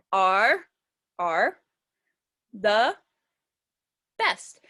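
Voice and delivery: one isolated word at a time; long pauses, each word a counted beat; counting syllables. Shown as a demonstration. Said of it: "let me count how many times my chin goes down".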